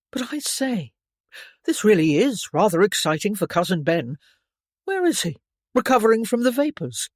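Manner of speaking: a touch of a gently mocking young dandy; teasing young gentleman. A narrator's reading. Clarence teasing Steph about Cousin Ben, light mockery.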